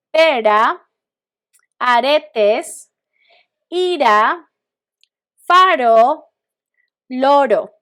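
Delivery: "Pera, aretes, ira, faro, loro" with a D-like tapped R; clear, loud repeat-after-me words, each R a quick D; soft R as D. Shown as a demonstration. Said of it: "say your letter D in English".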